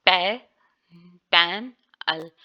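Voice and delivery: पैन अल said slowly as two syllables; पैन pause अल; split into syllables. Shown as a demonstration. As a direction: break पैन अल into two parts. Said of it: "जैसे इसको अगर हम पढ़ेंगे".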